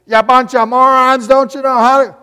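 mock critic yell. Fans heckling the winning coaches, mock shouting. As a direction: bark it like an angry fan in the stands. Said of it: "the criticism that we got".

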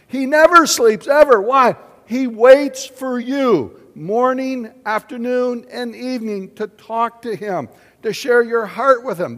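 loud, high, emphatic preaching; fervent pulpit proclamation. Acted. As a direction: preach it out with full force. Preaching at full force.

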